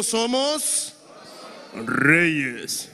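reyes dropped low and deep; a big manly declaration. Shown as a demonstration. Pastor shows the men how to say it, in a manly voice. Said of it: "Pero con voz de hombre".

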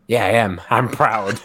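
loud, brazen retold boast; mock defiance. Speaker imitates woman owning up to racism, loud mock defiance. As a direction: declare it loudly, proudly unrepentant.

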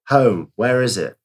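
ordinary talk

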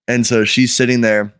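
usual speaking voice

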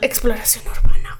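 hushed, eerie whisper. Real, not acted. Echoing a friend's term about creepy explorations, in a whisper. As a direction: breathe the words like a secret.

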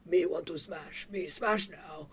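lightly voiced simple-minded demon; Fieral demon speaker. Explaining the Fieral language to cohosts, lightly voiced brute. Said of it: "in the Fieral language where they're like".

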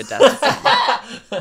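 laughing loudly through the words. Real, not acted. Joking about death scene.

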